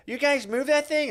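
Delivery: high-pitched, drawn-out imitation; whiny nasty driver impression; mock nasty demand. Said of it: "comes in fucking kinda nasty".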